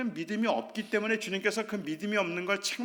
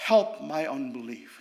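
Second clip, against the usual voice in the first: help my unbelief; 9 semitones lower, much slower than usual. Acted. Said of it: "아버지가 소리를 질러요".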